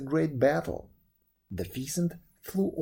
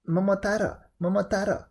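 raised, louder exclamation; crying out; 5 semitones higher, 4 dB louder, faster than usual. A narrator's reading. Old man naming the baby from the peach, crying out high. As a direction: cry out the name, high and strong. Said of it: "cried the old man".

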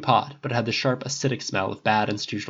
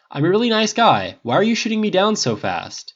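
high, louder frustrated protest; edge of frustration; 10 semitones higher, 5 dB louder than usual. A narrator's reading. Harris protesting being shut down by Tony, frustrated. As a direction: plead your case with rising frustration. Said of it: "frustration edging into his tone".

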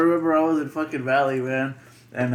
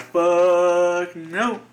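loud, higher, drawn-out refusal; emphatic mock refusal; 5 semitones higher, 5 dB louder than usual. Mechanic asked to look at a hybrid, emphatic mock refusal. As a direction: stretch the first word out loudly.

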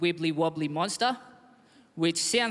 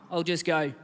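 usual speaking voice

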